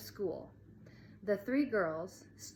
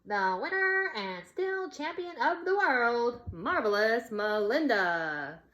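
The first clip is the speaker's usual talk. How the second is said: high, loud ring-announcer bellow; bellowed announcement; 4 semitones higher, 6 dB louder than usual. A narrator's reading. Girl announcing the fight winner, booming ring-announcer bellow. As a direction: announce the champ like a boxing ring. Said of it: "she bellowed".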